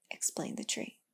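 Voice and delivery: plain reading voice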